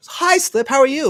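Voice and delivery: high, loud mock-cheery hello; friendly greeting. First person greeting a newcomer in chat, high and friendly. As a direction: imitate an eager welcome, pitched well up.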